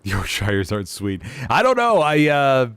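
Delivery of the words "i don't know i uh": sudden high-pitched, louder I don't know; high bemused I don't know. Real, not acted. To chat, a high baffled shrug. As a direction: blurt it out, caught off guard.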